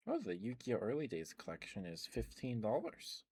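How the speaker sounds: normal talking voice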